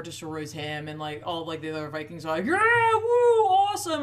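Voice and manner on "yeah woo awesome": mock crowd cheer. Vikings cheering the giant's defeat.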